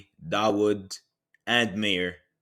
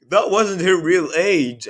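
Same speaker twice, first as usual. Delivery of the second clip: mocking quote; 8 semitones higher, 8 dB louder, slower than usual. Retelling liar's excuse, mocking.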